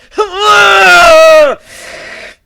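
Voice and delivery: loud, high, long mocking laugh; loud scornful guffaw. Griffin roaring with scornful laughter at the queen. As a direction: burst out laughing at the idea.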